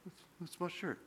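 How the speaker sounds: very soft, hesitant mumble of "It's, it's my shirt"; mumbled. Retold or imitated. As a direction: swallow "my shirt" sheepishly. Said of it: "Speak up".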